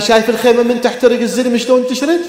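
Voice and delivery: high, loud mourning cry to the crowd; full-force lament preaching. Acted. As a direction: preach the tragedy at the top of your voice. (خطابة حسينية بأعلى صوت)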